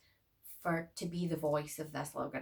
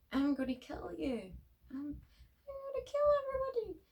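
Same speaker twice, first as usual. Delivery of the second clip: very high, shrill put-on doll with cackle; a badly acted killer doll, squeaky high; creepy doll voice. Vlogger voices the doll threatening everyone, high and creepy. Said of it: "shit acting from that doll".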